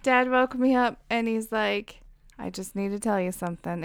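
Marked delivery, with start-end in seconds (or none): 2.4-3.8 soft, understated grave announcement; mildly solemn retold line; imitating serious dad. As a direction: say it quietly, as if bad news follows. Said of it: "he's, like, all serious".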